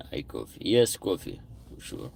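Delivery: ordinary talk, nothing marked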